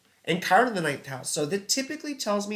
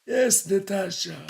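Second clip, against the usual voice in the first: higher, hammed-up cartoon Russian accent; Boris cartoon Russian accent; 4 semitones higher than usual. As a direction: play a cartoon Russian spy, thick accent. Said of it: "I'm doing Boris and Natasha".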